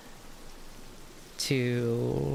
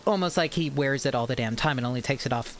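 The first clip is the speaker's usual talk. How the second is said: normal talking voice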